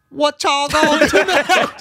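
high, loud, slow honky tonk taunt; honky tonk drawl over loaded weapons; hammed-up honky tonk challenge; honky tonk accent. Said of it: "say it in your honky tonk accent".

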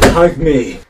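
very loud, abrupt yell; sudden shouted demand. Acted. Bursting out at friends mid-conversation, shouted demand. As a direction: blurt it out at full volume.

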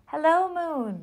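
high, loud call up to the sky; shouting. A narrator's reading. Bear calling up to the moon from a tree, shouting. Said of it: "he shouted".

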